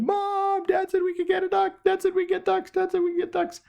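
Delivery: very high-pitched imitated squealing chatter; excited kids. Kids telling mom dad said yes, high excited imitation. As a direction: gush it out repeatedly like thrilled children. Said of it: "they start laughing and laughing".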